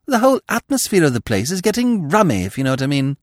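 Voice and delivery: put-on high, fretful young man's voice; fussing nervously about the house; jittery Freddy; anxious young toff. Freddy fretting to Smith.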